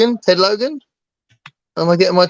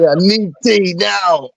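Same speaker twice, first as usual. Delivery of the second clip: slow, higher, emphatic demand; mock desperation; 4 semitones higher, slower than usual. Brit losing it without tea, mock desperate demand. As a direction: drag it out as an urgent mock demand.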